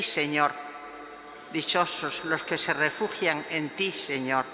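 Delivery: ordinary talk, nothing marked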